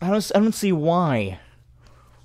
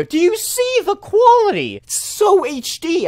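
much higher and louder, mock amazement; sarcastic praise; 14 semitones higher, 4 dB louder than usual. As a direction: gush over it with fake awe. Sarcastically praising a low-quality video.

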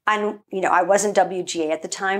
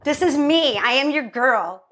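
high, drawn-out, hammed-up insistence; playful mock indignation; 9 semitones higher, slower than usual. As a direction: sell yourself with theatrical outrage. Acts out her indignant pitch to Sean.

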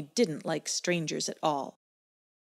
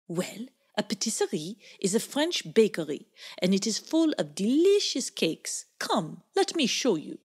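pleased French pug voice; 3 semitones higher than usual. Acted. Esmeralda's voice in story.

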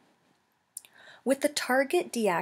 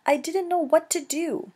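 put-on high-pitched, helpless young voice; scared cry; 9 semitones higher, 3 dB louder than usual. Gosling excusing himself to his mother, high and distressed. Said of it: "cried the goose".

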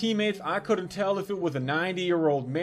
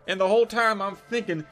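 usual speaking voice

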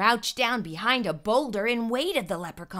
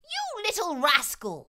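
arguing; 8 semitones higher than usual. Acted. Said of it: "Finnegan argued".

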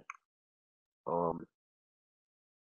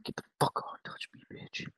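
hushed mock-tough whisper; whispered comeback in a mock fight; whispered taunt; 7 dB quieter than usual. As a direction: dare her under your breath. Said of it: "I was like".

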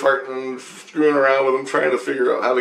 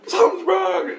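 mock panic. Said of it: "And he's like".

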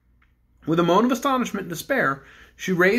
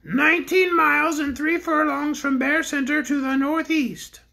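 the pink bear answers the king; small shrill voice; 8 semitones higher than usual. Acted.

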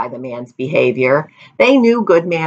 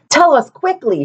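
mild shout; 10 semitones higher than usual. A narrator's reading. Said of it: "shouted the people".

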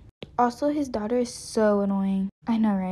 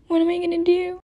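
high panicked worry; 8 semitones higher, 3 dB louder than usual. Acted. Tied up alone, despairing.

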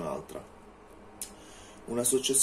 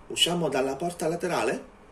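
higher and louder, a little urgent; a lightly voiced tense young woman; heroine voice; 8 semitones higher, 5 dB louder than usual. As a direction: ask it quickly, eager to get out. Heroine asks to leave.

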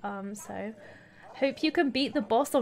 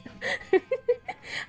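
breathless wheeze laugh; 13 semitones higher, 3 dB quieter than usual. Real, not acted. Laughing at her own squeak.

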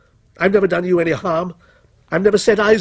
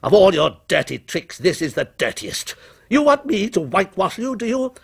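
angry outburst climbing ever higher; shouted denunciation; 7 semitones higher than usual. A narrator's reading. Author refuses his creation a good word, mounting angry outburst. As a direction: build from firm contempt to high defiance. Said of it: "he shouted".

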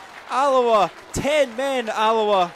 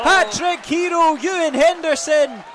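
higher, louder hat-trick call; goal shout; 6 semitones higher, 5 dB louder than usual. Real, not acted. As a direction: shout the hero's name. Hat-trick goal called live.

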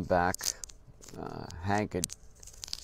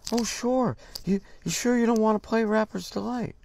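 much higher, louder mock surprise; surprised eager reply; 14 semitones higher, 5 dB louder than usual. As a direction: act like he can't believe his luck. Rival drummer eagerly doubting offer.

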